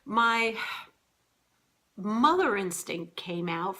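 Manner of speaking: ordinary talk, nothing marked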